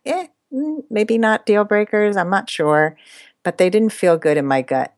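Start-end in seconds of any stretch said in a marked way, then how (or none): 0.0-3.0 mildly acted-out uncertainty, shrugging it off; wavering doubt. Woman voicing her old doubts about red flags, wavering.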